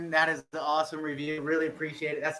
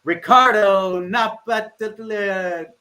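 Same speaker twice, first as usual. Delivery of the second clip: drawn-out announcement; 5 semitones higher, 6 dB louder, much slower than usual. Real, not acted. Drawn-out winner name reveal.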